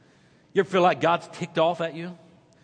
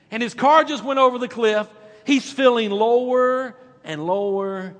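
lively, louder joke telling, slowing at the rain; storytelling; 4 semitones higher, 3 dB louder than usual. Acted. Pastor telling a joke to the church, dramatic. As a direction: spin the story with building drama.

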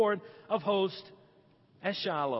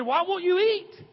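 husband pleading; 7 semitones higher than usual. Retold or imitated. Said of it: "He said".

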